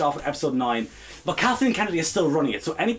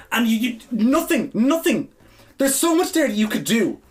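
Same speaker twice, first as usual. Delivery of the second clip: higher and higher on nothing; nothing rising in frustration; exasperated outburst; 7 semitones higher, 3 dB louder than usual. Real, not acted. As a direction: burst out in disbelief. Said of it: "I am livid".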